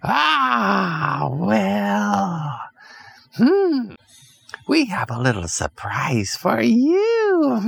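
high, slow wicked witch with a sneer; high witch voice ending in a cackle; sly witch voice. Acted. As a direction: tease them like a scheming witch. Witch threatens the psychos.

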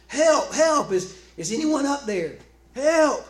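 desperate high, loud cry for help; yelling for help. Acted. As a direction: holler up from the bottom of a cliff. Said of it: "Jack began yelling for help".

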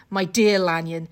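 ordinary talk

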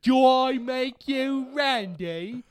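slow, high mock Cockney accent; Austin Powers. Podcaster having another go at the catchphrase, bad Cockney accent. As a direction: draw it out in hammed-up high Cockney. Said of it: "trying to do a Cockney accent".

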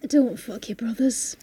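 slow, hushed mock-solemn whisper; soft, unhurried stage whisper; whispered advice. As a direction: whisper it like grave parting counsel.

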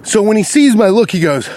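ordinary talk

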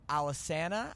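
Alesana said al-uh-SAN-uh, third A as in "cat"; Alesana with short A. Shown as a demonstration. Host unsure of the band's name, short-A Alesana. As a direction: try Alesana with a flat "san", questioning lift. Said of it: "I don't even know what it is".

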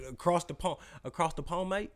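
trying out a British "across the pond, mate"; British accent try. Shown as a demonstration. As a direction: rehearse the British line, unsure. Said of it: "that what I should be".

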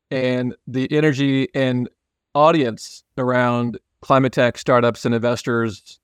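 normal talking voice

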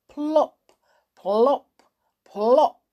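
high, popped single words; playful sound-effect plops; imitated raindrops. A narrator's reading. Narrator imitates raindrops falling.

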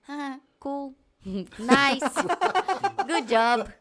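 loud, breezy fake enthusiasm; a hammed-up chirpy cool nice good job; mock absent-minded replies. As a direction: toss off empty praise like a bored nanny. Nanny mimics her distracted replies.

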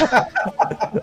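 breathy laugh. Real, not acted. Laughing at the dub.

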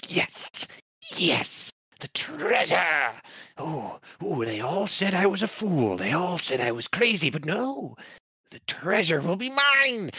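high, manic treasure gloat; greedy gloating. Acted. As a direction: cackle over your prize like a madman. NPC gloats over treasure.

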